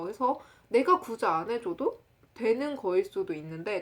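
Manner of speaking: ordinary talk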